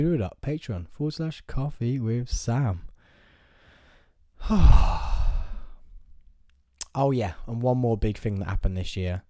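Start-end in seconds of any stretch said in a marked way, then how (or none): 4.4-6.1 long, loud falling sigh. Real, not acted. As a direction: heave out a big breathy sigh. Loud sigh before more wedding news.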